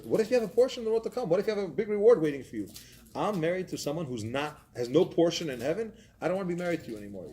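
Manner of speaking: upset wife. Retold or imitated. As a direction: act out an indignant wife's rapid outburst. Outraged wife confronts husband in story.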